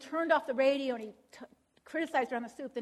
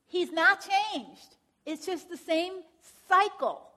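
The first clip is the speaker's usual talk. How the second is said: slow, louder, every word pressed; emphatic; 5 semitones higher, 5 dB louder, much slower than usual. Real, not acted. Teacher insisting to counselors, slow and emphatic. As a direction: hammer home the correction. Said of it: "correcting counselors' belief".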